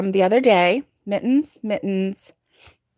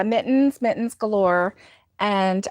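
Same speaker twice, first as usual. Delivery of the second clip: normal talking voice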